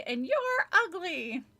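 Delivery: high, slow, through gritted teeth; ventriloquist's high dummy voice; squeaky dummy voice. Acted. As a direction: speak for the puppet without moving your lips. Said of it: "she made him say".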